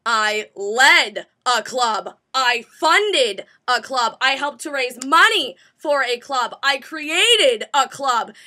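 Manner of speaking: LED, FUNDED, RAISE and CREATED punched loud and slow; emphatic verbs. Retold or imitated. Candidate selling herself to Jean, slow loud stress on LED, FUNDED, CREATED. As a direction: hit "led", "funded" and "created" hard, spacing each phrase.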